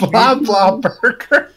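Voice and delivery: amused repeat. Acted. Cohost repeats the funny name.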